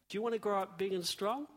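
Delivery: normal talking voice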